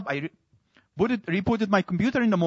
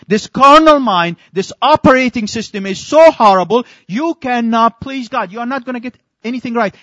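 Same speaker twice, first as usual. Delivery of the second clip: preaching; 4 semitones higher, 8 dB louder than usual. Acted. Preaching the verse.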